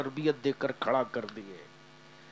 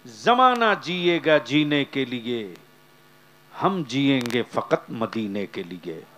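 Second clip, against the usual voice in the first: slow, loud, measured cadence; rhythmic pulpit declamation; slow fervent preaching; 5 dB louder, slower than usual. Acted. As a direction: drive each line home slowly.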